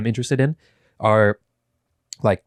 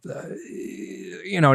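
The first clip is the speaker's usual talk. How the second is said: usual speaking voice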